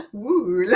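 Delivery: "wool" with a drawn-out OO; exaggerated wool. Shown as a demonstration. Repeating the funny word for viewers, long OO in "wool". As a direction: stretch "wool" on its OO, amused. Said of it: "it's supposed to be wall and wool".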